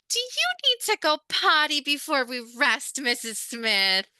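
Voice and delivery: put-on high, loud sing-song baby talk; teacher demos patronizing elder speak to a resident. Shown as a demonstration. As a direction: talk down to her like a toddler. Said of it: "my very best elder speak".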